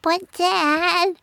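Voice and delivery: put-on high-pitched kid voice; little boy's high objection; protesting child. A narrator's reading. Child protests to his father.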